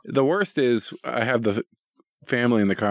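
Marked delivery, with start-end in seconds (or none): none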